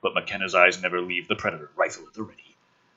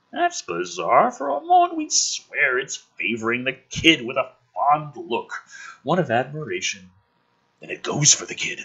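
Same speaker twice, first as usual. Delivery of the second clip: ordinary talk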